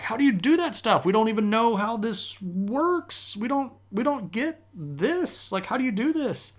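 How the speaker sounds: pleading to understand, wound up and incredulous; baffled amazement. Retold or imitated. Crusaders begging Byzantines to explain, high baffled excitement.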